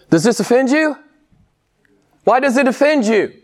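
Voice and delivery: loud, high pulpit challenge; forceful preaching. Real, not acted. Challenges the congregation.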